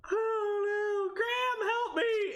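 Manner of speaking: high, panicky mock cry for help. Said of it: "And I'm gonna be like".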